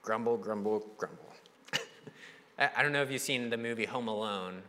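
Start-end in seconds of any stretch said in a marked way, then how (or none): 0.0-1.3 mock grumbling about the year. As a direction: grumble it under your breath, dying away. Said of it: "everything that doesn't go the way I want".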